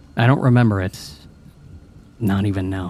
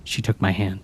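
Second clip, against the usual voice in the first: ordinary delivery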